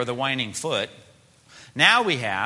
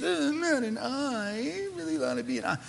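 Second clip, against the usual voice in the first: very high, stretched-out whine; whining; 12 semitones higher, 4 dB quieter than usual. Acted. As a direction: play a sulky, whining ear. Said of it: "there's the whining part of this".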